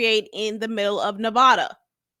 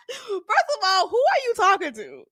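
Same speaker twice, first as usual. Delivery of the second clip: indignant; 7 semitones higher than usual. Retold or imitated. Mock indignant daughter.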